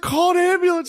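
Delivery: frantic high plea; shrill urgent request; urgent high call. Retold or imitated. Mom urgent after fall.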